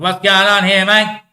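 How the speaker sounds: loud, high demand; calling out. Acted. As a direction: shout the question up at someone. Acted question put to a god.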